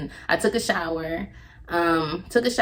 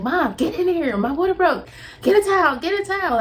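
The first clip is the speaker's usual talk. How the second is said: high, loud frantic commands; desperate calls for a towel; urgent yelling; 11 semitones higher, 4 dB louder than usual. Retold or imitated. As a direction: shout for help in a panic. Yelling for mom to help.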